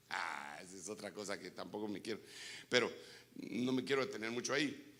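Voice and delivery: usual speaking voice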